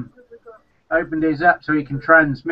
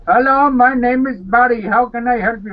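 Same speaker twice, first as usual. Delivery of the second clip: lightly voiced Indian call-centre agent, higher; imitating a phone agent's accent; a hint of an Indian call-centre greeting; mock call-centre accent; 7 semitones higher, 3 dB louder than usual. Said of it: "I like that when they phone up and say".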